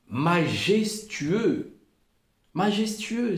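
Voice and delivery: majestueux drawn out slowly, each syllable weighed; stressing majestueux. Shown as a demonstration. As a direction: linger on majestueux. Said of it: "c'est le terme". (majestueux prononcé lentement, en appuyant)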